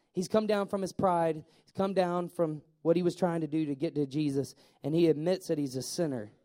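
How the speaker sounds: ordinary talk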